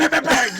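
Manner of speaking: loud, high rapid banging imitation; imitated door banging. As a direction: mimic pounding on a door. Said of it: "I hear this bang".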